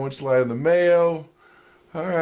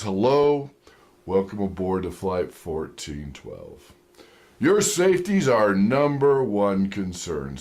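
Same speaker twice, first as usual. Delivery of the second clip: low, slow, deep pilot announcement; confident pilot voice; 8 semitones lower, slower than usual. Retold or imitated. Worst pilot welcoming passengers, deep and confident. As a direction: deepen and inflect down like a captain. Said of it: "very confidently says".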